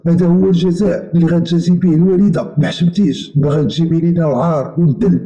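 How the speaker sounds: shaming his sister; reproach. A narrator's reading. Brother scolds sister. (أخ كيعاتب أخته بغضب)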